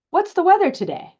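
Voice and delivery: "What's the weather today" pitched high and loud; question intonation. Shown as a demonstration. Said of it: "It should go up at the end".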